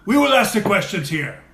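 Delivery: mock German accent. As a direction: bark it like a movie German interrogator. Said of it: "that's the way the Germans were".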